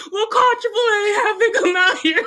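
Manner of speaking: high, tearful yell with laughter breaking through; yelling at mom to call triple A; retold yelling. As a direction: shout it at mom, half crying, half laughing. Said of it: "I'm, like, yelling at my mom".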